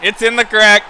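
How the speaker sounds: loud, high, thrilled cheer; excited. Real, not acted. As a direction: celebrate the goal out loud. Excited after goal.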